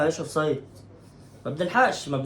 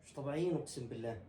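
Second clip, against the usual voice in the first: despairing mutter; 5 semitones lower, 17 dB quieter than usual. Real, not acted. Despair after miss.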